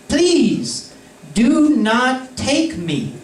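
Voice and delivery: high, slow mock plea; pleading note voice. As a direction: read the note like it begs you. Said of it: "the note says".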